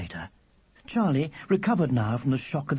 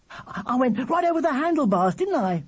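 put-on high, eager child's voice; piercing little brother; 11 semitones higher than usual. A narrator's reading. Charlie to his brother about the crash, piercing. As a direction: stumble in, high and keen. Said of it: "as loud and piercing as ever".

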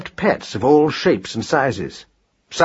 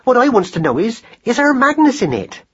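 high, fretful demand; anxious, snappish mouse mother; snapping; 11 semitones higher, 3 dB louder than usual. A narrator's reading. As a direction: push the question out impatiently, very high. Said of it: "snapped Madeline".

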